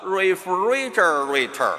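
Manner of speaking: slow American refrigerator with heavy curled Rs; American accent. Shown as a demonstration. Teacher showing American pronunciation, strong r-coloured refrigerator. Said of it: "刚才这就是美式发音".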